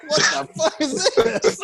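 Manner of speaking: high-pitched retold disbelief; incredulous. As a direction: squeal your baffled reaction to what you watched. Retelling own disbelief at the voice.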